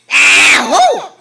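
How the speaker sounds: loud imitated sneeze ahoo; mock sneeze. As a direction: fake a big sneeze. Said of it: "what does Mama say when she sneezes?".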